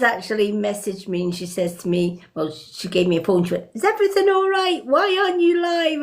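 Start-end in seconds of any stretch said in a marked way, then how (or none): 3.7-6.0 high, quick mock-worried questions; high-voiced retold fretting; imitating concerned friend. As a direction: mimic a friend checking up, pitched well up. Acts out friend's worried check-in call.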